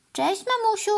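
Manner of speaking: put-on high, excited boy's call; shouted greeting. A narrator's reading. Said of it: "krzyknął Norman".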